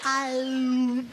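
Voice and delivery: drawn-out toy groan on one held note; imitated noise tube. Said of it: "it makes a noise".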